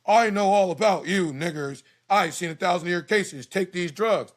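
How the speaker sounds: high, loud caricature of a condescending doctor. Retold or imitated. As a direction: ham up the doctor's contempt. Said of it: "doctor who says".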